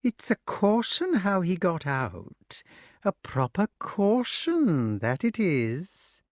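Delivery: slow, high, crooning witch; puzzled witch. A narrator's reading. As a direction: muse aloud as a suspicious old witch. Voices Madam Mim.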